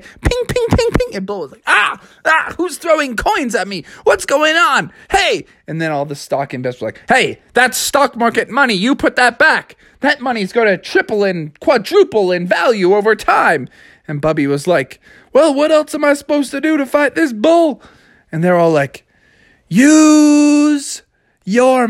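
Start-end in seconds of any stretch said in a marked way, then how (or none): 0.0-1.2 tiny, very high pings; ping like coins bouncing off a head; plinking coins. A narrator's reading. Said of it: "plinking on the bull's head". 1.7-5.4 high-pitched indignant protest; pelted, annoyed bull. Acted. 7.1-13.7 high, urgent scolding; indignant investors. Acted. Investors scold bear over money. 15.3-17.8 high-pitched, defensive bear; exasperated protest. Acted.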